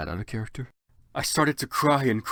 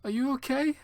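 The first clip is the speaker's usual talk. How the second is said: put-on girly concern. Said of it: "I asked".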